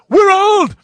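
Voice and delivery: high, loud shouted greeting; mock voicemail greeting. Imitating Howard's voicemail yelling at the caller. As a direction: blurt it out like a goofy answering-machine message.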